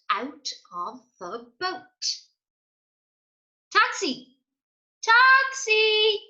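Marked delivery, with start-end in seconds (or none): 3.7-6.2 high, loud sung-out call; shouting. A narrator's reading. Denzel hailing a taxi, loud high call. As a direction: hail the taxi from afar. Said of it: "shouted Denzel".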